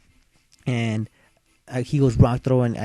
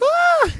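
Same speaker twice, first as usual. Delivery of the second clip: shocked yelp; 5 dB louder than usual. Retold or imitated. Opponent headbutts his Pokemon.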